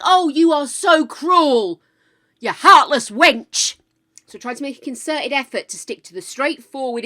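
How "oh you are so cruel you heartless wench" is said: loud, slow, hammed-up scolding; mock self-reproach. Berating oneself for confusing a dyslexic friend, mock-outraged scolding. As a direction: scold yourself theatrically, drawing out each word. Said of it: "I think".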